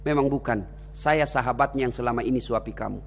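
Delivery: quiet, low, tearful words; tearful reply. Acted. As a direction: hold back tears as you answer. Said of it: "menetes air matanya".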